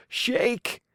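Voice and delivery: ordinary delivery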